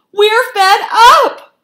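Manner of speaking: very high, very loud, slow cry; exasperated cry. A narrator's reading. Failed foxes complaining to the cook, crying out in exasperation. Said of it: "they cried".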